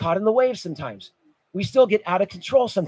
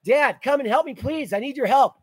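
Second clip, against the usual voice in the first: put-on higher-pitched child's plea for rescue; crying out; 6 semitones higher than usual. Preacher voicing a child in danger calling to Dad, crying out. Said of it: "He cries out".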